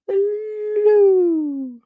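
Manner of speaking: high, long sing-song hello. Real, not acted. Greeting chatter [name], high sing-song hello. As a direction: lilt it way up high.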